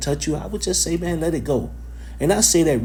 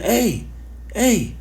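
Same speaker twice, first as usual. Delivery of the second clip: higher-pitched imitated call; woman calling out; 10 semitones higher than usual. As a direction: call after him like the woman on the street. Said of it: "She saying".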